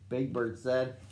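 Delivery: ordinary delivery, nothing marked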